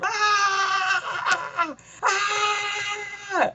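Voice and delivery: high, far-off screaming cry; electrocuted scream. Retold or imitated. Villain shocked by the battery, screaming. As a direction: scream as the current hits. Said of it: "He screams".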